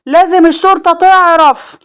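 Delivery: high, projected shout; loud call. A narrator's reading. Man in the crowd calling for police, loud. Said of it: "قال بصوت عالي".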